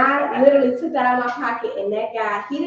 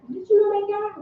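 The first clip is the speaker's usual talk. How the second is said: higher-pitched, mildly alarmed question; retold question; 9 semitones higher than usual. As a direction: voice a friend's question, pitched well up. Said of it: "she's like".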